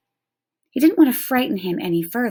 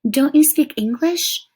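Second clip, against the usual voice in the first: put-on higher, louder puzzled boy; boy surprised the Indian might not speak English; 8 semitones higher, 5 dB louder than usual. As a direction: ask in boyish surprise. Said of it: "asked Omri".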